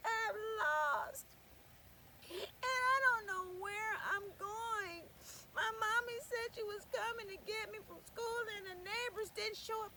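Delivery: put-on high, loud sobbing child; a lost child crying out her story; crying girl. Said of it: "And she said".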